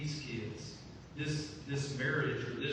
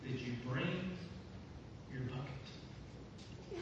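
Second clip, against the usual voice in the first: softer, slow, fading to a whisper; hushed teasing; 8 dB quieter, much slower than usual. Acted. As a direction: lean in and whisper the punchline. Teasing Russell in the room.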